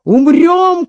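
rousing cry building to a high shout; rally the men, climbing to a peak; battle cry. A narrator's reading. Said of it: "кричит". (боевой клич с нарастанием)

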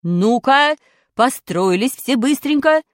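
loud, slow bossy teacher's order; strict teacher barking orders; commanding teacher. Acted. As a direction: order the class onto the stage. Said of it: "командовала она".

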